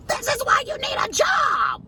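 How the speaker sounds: high shouted scolding at her son; mother yelling at her son; mock shout. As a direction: yell it at him down the phone. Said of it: "I'm like".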